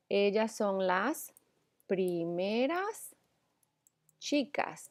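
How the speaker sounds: la primera chica spoken slowly, word by word; slow dictation. Shown as a demonstration. Teacher dictating an example sentence, slow and careful. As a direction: read it out slowly so students can copy it. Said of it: "dictating example sentence slowly".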